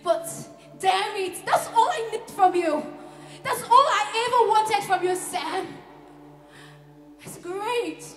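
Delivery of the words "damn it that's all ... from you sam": high, loud, pleading anger; exasperated, voice raised in demand; frustrated outburst. Acted. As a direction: burst out at your partner. Acted quarrel with partner Sam.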